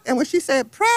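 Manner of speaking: ordinary talk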